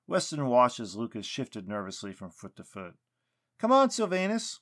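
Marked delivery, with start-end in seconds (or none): 3.6-4.6 loud, high angry yell. A narrator's reading. Lucas yelling at the tree to come out, loud. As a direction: shout it at the silent tree. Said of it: "Lucas yelled".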